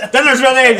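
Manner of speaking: loud call to dinner; calling kids to dinner. Retold or imitated. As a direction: shout it up the stairs. Parent calls kids to dinner.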